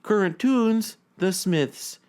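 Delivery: normal talking voice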